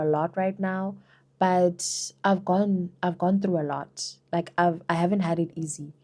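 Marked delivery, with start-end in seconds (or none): none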